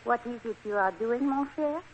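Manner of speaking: put-on French accent; phony French accent. Wife asks husband what he is doing, phony French accent. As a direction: question him in a fake French accent. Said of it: "My phony French accent".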